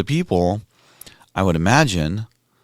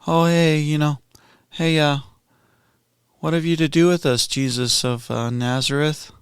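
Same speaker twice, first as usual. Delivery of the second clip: breezy and offhand, pitched higher, like a friendly hello; casual mock greeting; 7 semitones higher than usual. Preacher mocks the demon as casually chatty, offhand. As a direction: toss it off as if greeting a pal. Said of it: "Okay, not just like".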